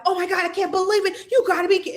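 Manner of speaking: high, shocked wail in the bathroom; going crazy in the bathroom over the scale; shocked disbelief. Retold or imitated. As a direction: freak out at the scale, voice way up. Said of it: "I'm like".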